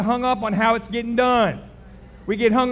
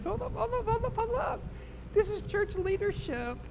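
very high, quiet squeal; high hushed disbelief; 13 semitones higher, 6 dB quieter than usual. Real, not acted. Preacher stops over cursing church leaders, high hushed disbelief.

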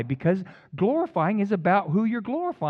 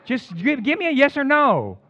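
exasperated demand; 8 semitones higher, 3 dB louder than usual. Retold or imitated. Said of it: "And I".

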